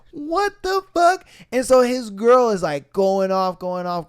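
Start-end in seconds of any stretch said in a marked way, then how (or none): 0.1-1.2 high, slow, shocked disbelief; stunned what the fuck. Retold or imitated. Telling friends about his drummer, slow high shocked outburst. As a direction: stretch it out, completely floored.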